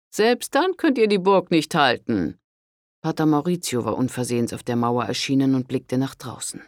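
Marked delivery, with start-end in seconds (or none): 0.1-2.4 high, loud, stern rebuke of a priest. A narrator's reading. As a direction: scold her like a severe father confessor. Priest speaks, same voice earlier. (strenger Pater, tadelnd)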